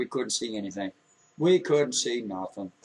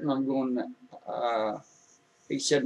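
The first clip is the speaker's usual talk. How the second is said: ordinary talk, nothing marked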